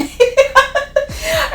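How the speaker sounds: real laugh going high. Real, not acted. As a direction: burst out laughing at the memory. Laughing at the memory.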